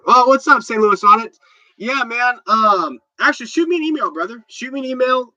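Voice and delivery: loud, high, pumped-up hello; excited greeting. Real, not acted. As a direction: shout out a buddy with energy. Greeting a friend in chat.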